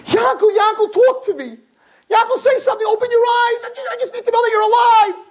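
frantic screaming mother. Retold or imitated. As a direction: scream for the child to wake. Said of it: "she starts screaming".

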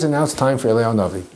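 ordinary talk

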